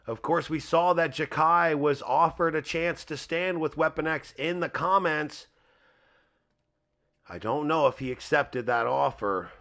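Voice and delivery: ordinary delivery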